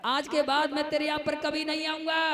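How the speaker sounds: rising to a high, strained cry; scold, then throw out the final refusal; acted husband's indignant outburst; angry vow. Angry husband vows never to return.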